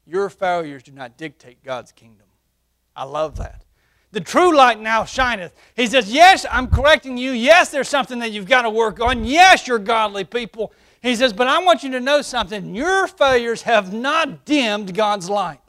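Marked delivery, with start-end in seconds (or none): 4.1-15.6 "The true light now shineth" proclaimed high and loud; emphatic preaching. Acted. Pastor proclaiming the verse to his church, loud.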